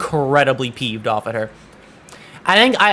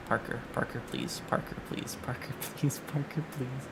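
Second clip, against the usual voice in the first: quiet pleading; 5 semitones lower, 17 dB quieter than usual. Real, not acted. Keeps quietly begging game character.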